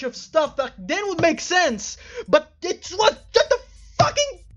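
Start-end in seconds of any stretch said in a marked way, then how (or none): 2.3-4.4 strained laugh-cry, voice cracking high; laughing in frustration. Real, not acted. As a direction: laugh at the absurdity as it breaks.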